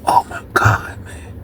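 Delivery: whispered disbelief; stunned whisper. Real, not acted. Watching through the window as the man loses again, whispered. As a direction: breathe it out under your breath.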